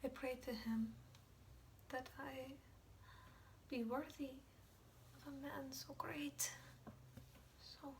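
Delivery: halting, reverent softness; quiet and prayerful, searching for words; hushed, pained confession. Acted. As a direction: confess it softly, with long pauses. Hushed confession of love for father.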